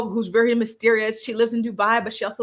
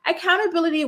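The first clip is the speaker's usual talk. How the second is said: incredulous; 8 semitones higher, faster than usual. Retold or imitated. Recapper mocking his demand, incredulous. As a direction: pitch leaps up in disbelief. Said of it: "And I'm like".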